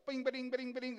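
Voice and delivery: rapid high bouncing nonsense syllables; playful mimicry of people speaking in tongues; imitated tongues. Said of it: "they're speaking in tongues".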